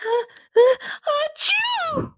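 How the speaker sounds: high build-up into a sneeze. A narrator's reading. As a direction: wind up and sneeze. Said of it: "Rocky sneezed".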